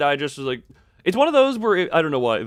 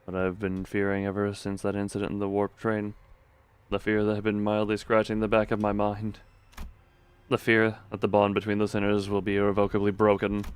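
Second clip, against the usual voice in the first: story narration; 4 semitones lower, 5 dB quieter than usual. A narrator's reading. Reads game narration.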